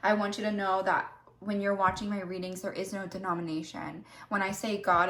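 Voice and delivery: normal talking voice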